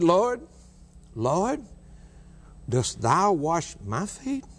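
high, rising astonished question; astonished Peter. Acted. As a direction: voice Peter's disbelief, slowing at the end. Peter astonished at Jesus washing feet.

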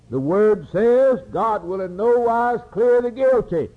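high, rising, full-force sermon; fiery preaching. Acted. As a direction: preach it up, building the judgment scene. Preaching judgment scene at full force.

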